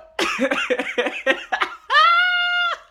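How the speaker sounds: high screaming laugh; shrieking laugh. Real, not acted. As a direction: burst into a loud shriek of laughter. Burst of laughter.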